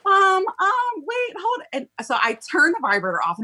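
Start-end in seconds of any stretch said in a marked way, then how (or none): 0.0-1.6 high, flustered stammer; flustered stalling. Retold or imitated. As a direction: scramble to stall the caller, pitched way up. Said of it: "I'm like".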